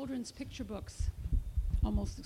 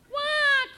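loud, high acted baby cry; high mock-crying "wah"; baby's wail; 10 dB louder than usual. A narrator's reading. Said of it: "cried baby".

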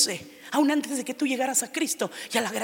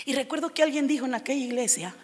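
usual speaking voice

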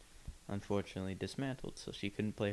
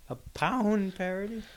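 high, slow, disbelieving echo; mock incredulity; 9 semitones higher, 6 dB louder, slower than usual. Co-host querying a sexy parody, mock incredulity. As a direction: question POUND slowly in a high, louder voice.

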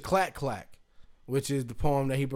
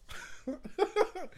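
high laugh; 8 dB quieter than usual. Real, not acted. Laughing after imitation.

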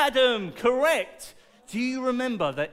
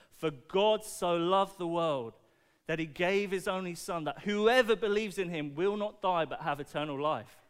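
scripture recital; 4 semitones lower than usual. Acted. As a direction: recite it like a solemn Bible verse. Quotes famous verse.

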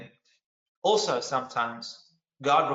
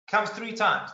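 ordinary talk, nothing marked

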